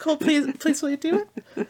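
high, rushed pleading; begging fan. Retold or imitated. Fan begging the actor to return as Cole, high and rushed. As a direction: beg the actor eagerly and fast. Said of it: "It's like".

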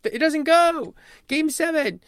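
high, excited play-by-play voice; announcer call. Pretend. Broadcaster calling the championship-winning rebound, excited announcer. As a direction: call the final seconds like TV.

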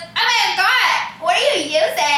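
put-on high, gushing drunk passenger; mock a tipsy stranger admiring makeup; drunk woman, imitated. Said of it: "This other drunk bitch".

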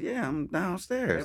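a hint of a coy girl, drawn out. Prank caller telling Mike she's downstairs, mild girl voice. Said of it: "So I act like I was a girl".

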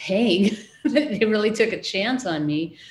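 amused, with a laugh in it. Real, not acted. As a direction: say it through a chuckle. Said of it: "You can hear the smile in her voice".

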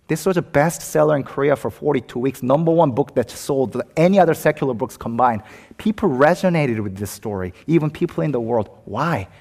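normal talking voice

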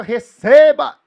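loud goal cry; shouted catchphrase. Retold or imitated. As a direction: yell it like scoring a goal. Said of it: "O moleque chutava a bola no gol e falava".

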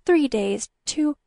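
high, slow, broken-off begging; whispered plea. A narrator's reading. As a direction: plead haltingly, close to tears. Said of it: "she whispered".

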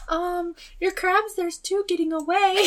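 high, timid, slow voice; meek polite voice. Retold or imitated. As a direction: politely squeak it out. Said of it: "And Ria's like".

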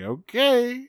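high, bright eager reply; chipper compliance. Retold or imitated. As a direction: answer far too cheerfully. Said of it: "He's like".

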